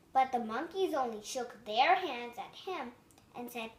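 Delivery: ordinary delivery, nothing marked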